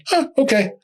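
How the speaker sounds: high, light shrug of an okay; retold casual acceptance. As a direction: take the news as if it were nothing. Retold casual reaction to cancer odds.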